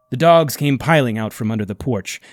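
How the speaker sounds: ordinary delivery, nothing marked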